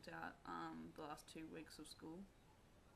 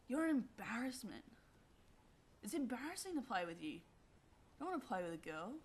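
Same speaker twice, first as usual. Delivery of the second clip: mocking jeer; 5 semitones higher, 6 dB louder than usual. Bully jeering at her.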